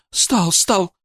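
high, eager woman's voice; excited confirmation. Acted. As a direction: confirm it quickly in sudden recognition. Wife excitedly confirms the symptom. (взволнованно подтверждая, высоким голосом)

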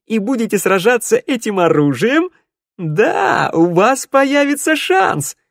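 high, loud triumphant minstrel; jubilant minstrel. Acted. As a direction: crow with joy.

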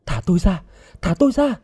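high-pitched frantic plea; panicked cry. A narrator's reading. Bound girl begs her captor to release her, panicked cry. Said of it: "Cô hoảng hốt kêu lên".

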